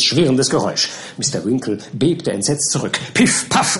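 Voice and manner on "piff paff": high, percussive bang noises; imitated gunshots. A narrator's reading. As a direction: pop out two sharp shots. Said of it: "erscholl es".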